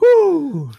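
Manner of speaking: high, loud whoop; mock nervous whoop. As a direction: let out a retold whoop of nerves. Nervous whoop before the dive.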